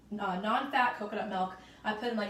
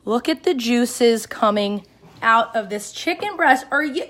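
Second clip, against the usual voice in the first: excited; 2 semitones higher, 15 dB louder, slower than usual. Real, not acted. Showing juices to the camera.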